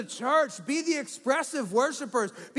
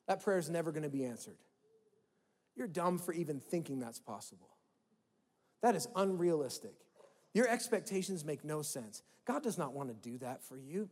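cynical inner voice; 7 semitones lower, 8 dB quieter than usual. Retold or imitated. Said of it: "Maybe yours is cynical".

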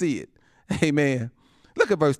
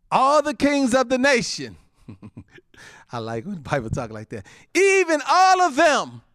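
proclaiming scripture; 9 semitones higher, 4 dB louder than usual. Acted. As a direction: declare it like a herald.

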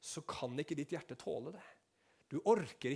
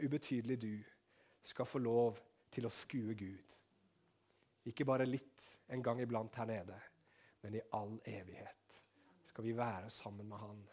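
ordinary talk

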